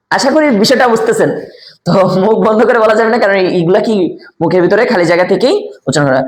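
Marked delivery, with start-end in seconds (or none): none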